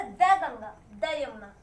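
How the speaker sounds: द Ganga, द Yamuna with short "duh". Shown as a demonstration. Teacher to class, short "duh" on द. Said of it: "इसी वजह से हम क्या बोले".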